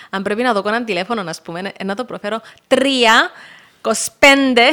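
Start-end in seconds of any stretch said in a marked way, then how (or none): 2.7-4.6 loud, slow, clearly spoken digits; co-driver calling numbers loudly and slowly. Shown as a demonstration. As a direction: read the numbers out as if over a helmet intercom. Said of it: "Συνήθιζα τα νούμερα, τα λαλώ έτσι λίγο".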